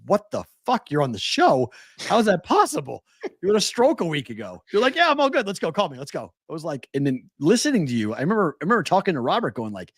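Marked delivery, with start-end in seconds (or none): 0.1-4.6 high-pitched, baffled protest; retold incredulity. Co-host to friend who had a stroke, incredulous. Acts out disbelief at stroke patient. 4.9-6.3 high, mock-eager brush-off; breezy eagerness. Friend asking to be called for the show, eager.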